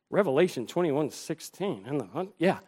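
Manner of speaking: soft, low mumbling while squinting at small print; squinting to read. Shown as a demonstration. Aging pastor showing he can't read the Bible, squinting murmur. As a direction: peer at the page and mumble. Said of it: "Now I do this".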